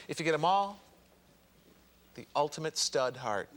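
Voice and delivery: low, weighty prize announcement; hushed big reveal. Acted. Host unveils the top prize to contestants, hushed big reveal. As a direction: drop the pitch and pause for suspense.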